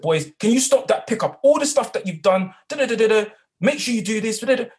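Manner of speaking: high and loud, firm telling-off. Retold or imitated. Teaching assistant telling off unruly boys, loud and high. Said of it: "I raised my voice".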